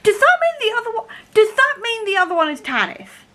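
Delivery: high-pitched, breaking off and restarting; excited realisation. Real, not acted. Fan working out who the other sister is, excited. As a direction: blurt the theory in rising excitement. Excitedly guessing the plot twist.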